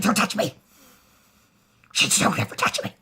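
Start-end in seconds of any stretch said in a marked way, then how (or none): 0.0-0.5 a loud cry of don't touch me; angry shout. Retold or imitated. She shouts at Valar. 2.2-2.9 a loud cry of don't touch me; angry shout. Retold or imitated. She shouts at Valar again.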